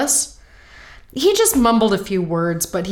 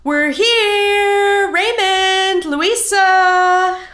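high excited call; 10 semitones higher, 5 dB louder, much slower than usual. Acted. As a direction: call out into the empty house. Calling out on arrival.

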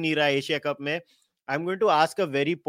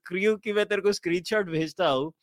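ordinary talk

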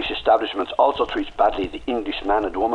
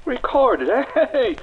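mocking echo; 3 dB louder, much slower than usual. Prisoner mocks the word recorded.

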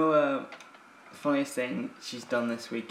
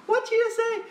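squeaky disbelief; 7 dB louder, slower than usual. Retold or imitated. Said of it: "I was like".

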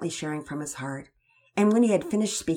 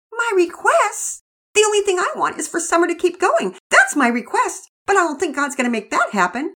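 put-on higher and louder, a frustrated little girl; upset young girl; 13 semitones higher, 10 dB louder than usual. Girl to her father, higher and louder, frustrated. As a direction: push back at dad, near tears.

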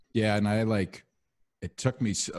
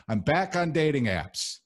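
much higher, proud repeat; excited announcement; 8 semitones higher than usual. Shown as a demonstration.

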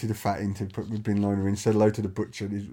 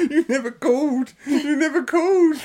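high, hammed-up wail of betrayal; mock jilted lover. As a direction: whine it like a soap heartbreak. Acting jilted lover bit.

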